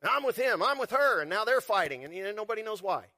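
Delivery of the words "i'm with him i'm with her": mock bystander. Voicing onlookers taking sides.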